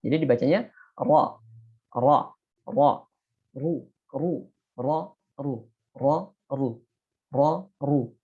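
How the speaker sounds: ra and ru said heavy and full; thick ra. Shown as a demonstration. Teacher drills thick ra with fathah and dhammah. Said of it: "Ini harus tebal".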